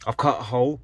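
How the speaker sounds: usual speaking voice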